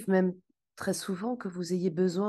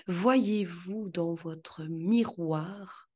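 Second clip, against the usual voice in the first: ordinary talk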